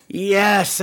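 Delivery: ordinary talk, nothing marked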